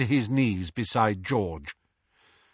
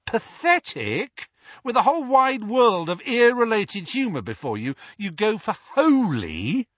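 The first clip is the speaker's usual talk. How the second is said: teasing mock outrage; 11 semitones higher, 4 dB louder than usual. A narrator's reading. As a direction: tease him with fake indignation. George teasing back.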